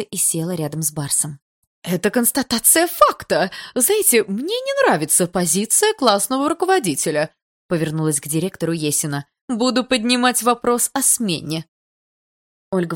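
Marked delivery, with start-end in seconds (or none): 1.8-7.3 high, loud haughty objection; angry mother pulling rank; angry insistence. A narrator's reading. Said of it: "Есина рассердилась ещё сильнее". 9.5-11.6 slow, high, loud warning; indignant ultimatum; angry threat. Acted. Angry mother threatens the teacher.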